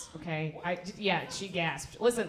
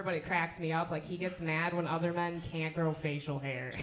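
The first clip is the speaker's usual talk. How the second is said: ordinary talk, nothing marked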